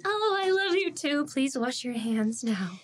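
imitated sugary teacher voice; teacher to pupil. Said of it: "And you're like".

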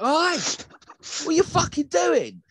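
high, drawn-out angry call; indignant yell. Retold or imitated. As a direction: holler over the fence in outrage. Yelling at cake-throwing children over fence.

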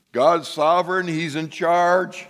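He's in charge, slow and weighty; slow emphatic declaration. Real, not acted. Preacher proclaiming to the congregation, slow and firm. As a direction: lay it down word by word.

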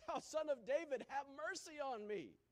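high, full-voiced desperate call. A narrator's reading. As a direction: shout it over those hushing you. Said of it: "But he cried out a more, a great deal".

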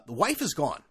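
usual speaking voice

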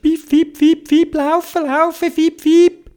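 very high, urgent cheeping; twittering bird. A narrator's reading. Bird urges the bear to run, high frantic twitter. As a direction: peep and chirp the words like a tiny bird. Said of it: "sogar der Vogel hat zwitschert".

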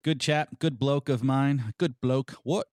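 hammed-up, drawn-out attempt at an English accent; bad English accent. Host teasing British guest as a good bloke, bad English accent. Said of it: "horrible attempt at an English accent".

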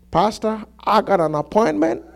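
hushed, half-laughing imitation of an excuse; mimicked excuse. Church member begging off to the pastor, soft mock excuse. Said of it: "The way they said it".

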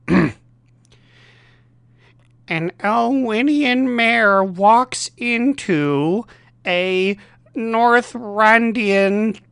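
deliberate punchline, very slow; milk the setup slowly; slow joke delivery. Pretend. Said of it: "here we go".

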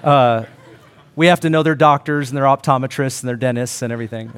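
ordinary delivery

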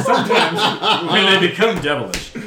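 hammed-up game master proclamation; dramatic repeat. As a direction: boom it out over the laughter. Repeats line after music change, laughter.

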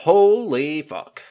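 slow, drawn-out stunned swear; stunned awe. Retold or imitated. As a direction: let it fall out of you, amazed. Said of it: "I would have been".

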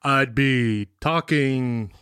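drawn-out, halting slow speech; very slow, word by word, rising at the end; slow talking. Shown as a demonstration. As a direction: demonstrate slow, laboured language-learner speech. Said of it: "my response would be, like".

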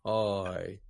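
drawn-out slow speech; slow-motion voice. Shown as a demonstration. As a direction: stretch every word out slowly. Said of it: "play this back at fast speed".

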